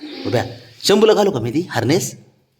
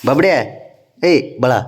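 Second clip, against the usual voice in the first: ordinary delivery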